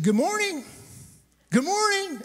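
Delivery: high, loud sing-song good morning; calling out loud. Retold or imitated. Pastor calling out to a couple behind a store, loud and high. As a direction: call out to wake them from a distance. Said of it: "I had to get a little loud".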